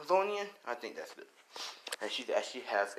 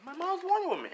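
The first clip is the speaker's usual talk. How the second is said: put-on very high-pitched squealing kid voice; excited child yelling. A narrator's reading. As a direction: squeal it out like a thrilled, boasting little girl. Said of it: "As Melissa starts yelling".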